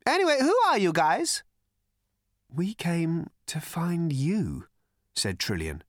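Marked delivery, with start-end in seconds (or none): 0.1-1.4 very high, loud, curious voice; manic Prack. A narrator's reading. Prack asks the strangers who they are, high and manic. 2.5-4.7 high, very slow, let-down woman's voice; open disappointment. A narrator's reading. Trillian tells Prack why they came, slowly, disappointed. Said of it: "not keeping the disappointment out of her voice".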